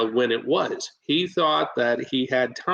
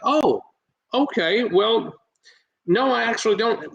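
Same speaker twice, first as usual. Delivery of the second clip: higher-pitched, hesitant backing down; imitating taken-aback homeowner; 8 semitones higher than usual. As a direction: play the caught-off-guard homeowner, pausing between words. Retold homeowner backing down after comeback.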